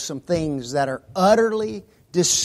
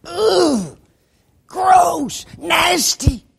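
loud, high disgusted cries; 12 semitones higher, 4 dB louder, much slower than usual. Pretend. Acting out disgust.

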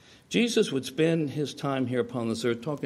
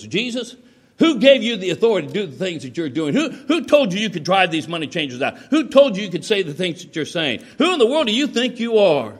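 raised, loud interrogation, building to the end; indignant challenge; 6 semitones higher, 6 dB louder than usual. Retold or imitated. Scribes challenge Jesus over his authority, indignant challenge. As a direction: hammer out each accusing question, acting outraged.

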